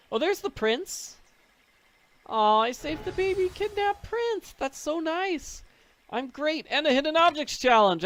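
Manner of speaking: very high, gushing squeal; squealing excitement. Acted. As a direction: gush over it like a cute baby. Gushing over rescuing baby prince.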